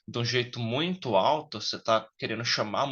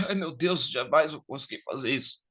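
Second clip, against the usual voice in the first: mock despair; 3 semitones higher than usual. Nervous guy imagining talking to the girl, mock despair. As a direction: sigh it out like you'll never manage. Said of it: "sentimento de desespero".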